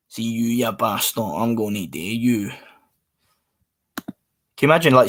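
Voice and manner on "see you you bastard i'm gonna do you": mock-menacing Glaswegian accent, dropping lower; Glasgow accent. Streamer imitating a Glasgow local's threat, mock Glaswegian. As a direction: put on a slow Glasgow threat. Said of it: "that's probably what I'd sound like".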